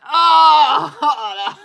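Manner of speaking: loud, high burst of disgust; a loud, high recoil at hearing the ex; hearing the ex on the phone, a loud disgusted Oh. Retold or imitated. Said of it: "this noise of disgust".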